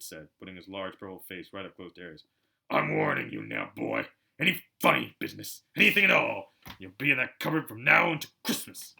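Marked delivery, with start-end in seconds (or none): 2.7-8.9 menacing uncle. A narrator's reading. Uncle threatens Harry up close.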